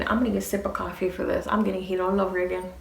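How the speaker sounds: normal talking voice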